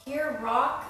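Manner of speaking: ordinary talk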